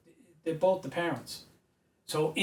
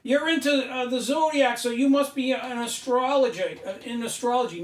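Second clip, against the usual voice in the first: high, mocking voice of a dismissive critic; parroting the critics who call him an astrologer, mockingly; mocking skeptic; 8 semitones higher than usual. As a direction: sneer it out like a know-it-all doubter. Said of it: "I don't wanna hear".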